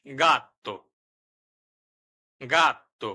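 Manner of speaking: গাত্তো with a hard G and a doubled t; Italian word demo. Shown as a demonstration. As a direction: say গাত্তো slowly, like a teacher modelling Italian. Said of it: "উদাহরণ".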